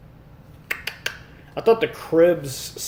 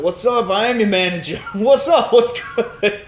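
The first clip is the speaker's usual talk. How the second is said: manager character; 6 semitones higher than usual. Retold or imitated. Quoting the manager greeting the new hire, hyped up. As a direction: pump it up like a hype manager. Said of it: "he was like".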